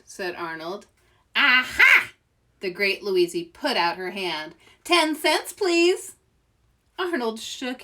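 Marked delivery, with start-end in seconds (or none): 1.4-2.1 triumphant aha. Acted. Louise triumphs. 4.8-6.2 demanding payment. Acted.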